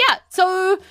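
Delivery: breezy reassurance. Acted. Nurse Clara breezily downplays the dangerous tower. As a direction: make it sound like nothing. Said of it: "nurse breezily describes the tower".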